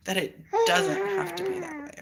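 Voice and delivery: laughing through tears. Real, not acted. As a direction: let it break between laugh and sob. Emotional breakthrough, laugh-cry.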